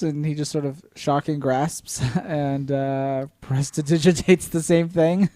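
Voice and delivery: ordinary talk, nothing marked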